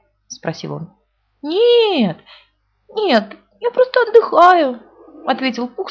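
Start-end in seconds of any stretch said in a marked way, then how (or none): 1.4-4.8 much higher, louder, slow fake cheer; forced cheerful. A narrator's reading. Stuck Pooh fakes cheer to rabbit, high. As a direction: pretend all is fine. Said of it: "стараясь говорить весёлым голосом".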